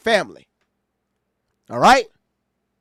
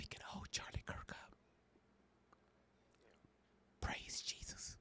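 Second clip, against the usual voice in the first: playful mocking whisper; teasing hushed whisper; 9 semitones lower, 29 dB quieter than usual. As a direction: keep whispering it to the chat. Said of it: "she the one that's talking like that".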